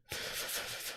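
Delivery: long, soft breathy sigh; resigned sigh. Real, not acted. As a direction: let out a quiet sigh of defeat. Concedes his favourite is out.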